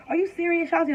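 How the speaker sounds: high-pitched mock disbelief; incredulous mom impression, pitched high; mom freaking out. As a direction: imitate an alarmed mom, voice jumping up. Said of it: "My mom immediately kind of freaks out".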